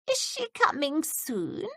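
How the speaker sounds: high, slow hopeful quaver; hopeful old woman. A narrator's reading. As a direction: ask like a hopeful frail old lady. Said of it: "Miss Bush asked hopefully".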